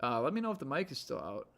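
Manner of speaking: usual speaking voice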